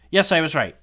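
high, loud burst of triumph; yes, you nailed it; excited. Real, not acted. To viewers after checking the cast list, triumphant. Confirming her guess about the cast.

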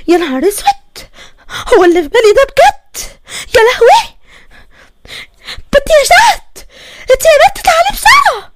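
high, loud cry for help; building from shock to a piercing summons; panicked shouting. Acted. Panicked, calls other daughter quickly. (صريخ مفزوع بينادي بسرعة)

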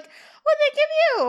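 very high, loud, drawn-out mock question; hammed-up, over-eager squawk; Topanga's over-earnest energy. Mimicking Topanga in a very tense scene, weirdly over-earnest. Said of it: "it's very weird energy to be bringing".

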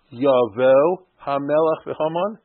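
Yavo Hamelach Haman read very slowly, Yavo rising; slow drawn-out reading. Shown as a demonstration. Teacher reads the verse slowly to students. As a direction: spell it out slowly for the class. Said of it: "you know enough Hebrew".

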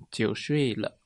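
ordinary delivery

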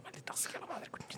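hushed muttered swear under breath; whispered curse. Retold or imitated. Susurro al contar su frustración. (maldición susurrada)

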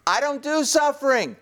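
hammed-up objection to God; indignant cat. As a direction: start incredulous, rise into high-pitched protest. Acts a cat refusing to suffer.

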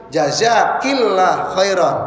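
jazakillah khairan said slowly, with a clear ki syllable; slow jazakillah khairan, set out for the class to copy; slow model phrase. Shown as a demonstration. Teacher showing what to say to one woman, slow and clear. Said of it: "kalau ibu seorang ngasih ke saya".

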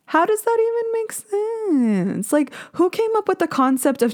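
squeaky-high exasperated bafflement; incredulous. Real, not acted. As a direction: stretch it out in baffled protest. Baffled protest at being judged.

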